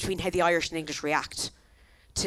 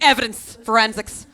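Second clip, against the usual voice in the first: mock excitement; 3 semitones higher, 7 dB louder than usual.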